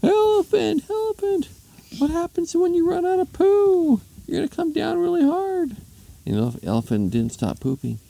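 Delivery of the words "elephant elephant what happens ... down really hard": very high falsetto shriek; piercing mock-woman scream; screamed warning. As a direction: shriek it up to someone far overhead.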